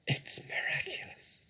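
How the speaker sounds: soft whisper fighting for breath; breathless whisper. A narrator's reading. Fox whispers after a huge gulp of cider. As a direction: whisper it, throat burning. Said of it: "he whispered".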